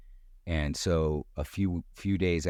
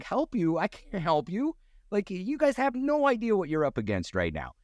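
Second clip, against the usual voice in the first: incredulous. Retold or imitated. Himself refusing APs, incredulous.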